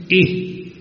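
I said as short i, high. Shown as a demonstration. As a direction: give the short I sound. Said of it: "so this is".

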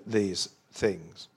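ordinary talk

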